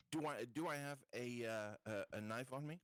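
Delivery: normal talking voice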